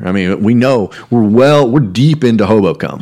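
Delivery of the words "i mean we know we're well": ordinary talk, nothing marked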